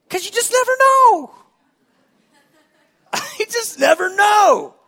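high, loud, delighted exclamation; excited shout. Real, not acted. Excited about God moving.

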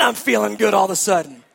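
ordinary delivery

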